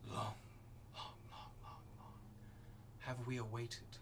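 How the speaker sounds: eerie whispered chorus voice; eldritch horror. Acted. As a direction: whisper like many voices at once. Voice of many from flames.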